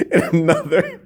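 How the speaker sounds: usual speaking voice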